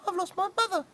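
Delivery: high squeaky chick voice; cheeping chick. Acted. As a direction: peep it like a lost chick. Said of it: "cheep little chick".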